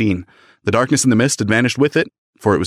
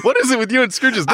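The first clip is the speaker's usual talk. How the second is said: incredulous; 13 semitones higher than usual. Real, not acted. Incredulous at friend's joke.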